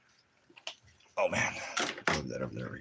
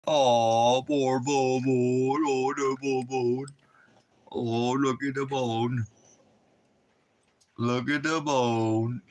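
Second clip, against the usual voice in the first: cooing pet voice; pet talk; 4 semitones higher, 4 dB louder, slower than usual. Acted. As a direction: coo lovingly at the dog. Cooing at the dog.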